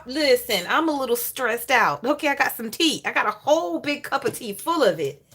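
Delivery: usual speaking voice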